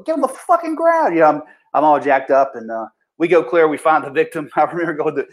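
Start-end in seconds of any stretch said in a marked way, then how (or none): 0.0-1.1 very high-pitched mock yell, no real volume; retold yell. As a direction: re-enact yelling an order at a suspect. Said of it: "I'm yelling at that".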